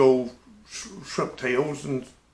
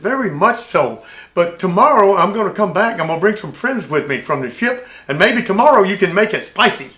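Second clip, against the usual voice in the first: mock bravado; 5 semitones higher, 7 dB louder than usual. Teasing the waiter at the door.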